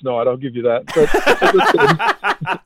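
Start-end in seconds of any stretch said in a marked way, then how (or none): 1.4-2.7 breaks into high laughter; laughing. Real, not acted. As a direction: laughs through the excuse. Husband laughs mid defence.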